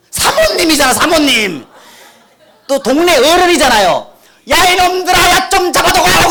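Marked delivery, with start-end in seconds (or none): 4.5-6.3 put-on high, loud laughing yell to the boys; mock shout. Mother yelling to village boys, high loud laughing yell. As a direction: call the village boys to catch him. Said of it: "그러는 거예요".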